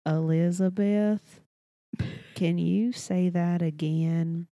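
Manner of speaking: deliberately stretched, unhurried drawl; very slow cadence. Retold or imitated. Cousin answering fast-talking Elizabeth, very slow drawl. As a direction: draw every word way out. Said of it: "it's a slow cadence as well".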